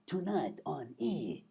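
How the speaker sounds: slow, soft TV promo voice; drawn-out channel announcer impression; voiceover guy. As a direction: purr out the promo slowly. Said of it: "the voiceover guy. He's always like".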